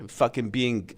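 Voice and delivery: ordinary talk, nothing marked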